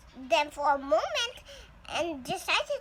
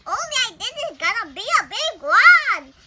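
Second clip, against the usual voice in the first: much louder, higher burst of excitement; excited papa; 6 semitones higher, 13 dB louder than usual. Acted. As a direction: blurt it with thrilled anticipation. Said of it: "Papa said excitedly".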